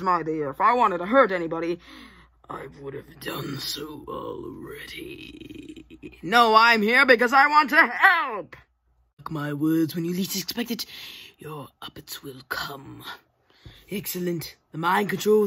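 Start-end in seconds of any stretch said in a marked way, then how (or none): 2.5-6.2 low, soft, slow menacing voice; menacing villain. Acted. 9.2-13.0 ominous hush. Acted. Ominous prophecy.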